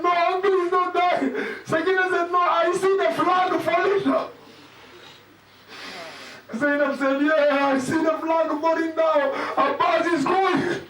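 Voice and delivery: high, keening lament; mournful recitation. Acted. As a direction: cry out the dialogue in a high mourning chant. Laments through family dialogue.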